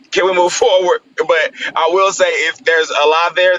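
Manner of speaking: normal talking voice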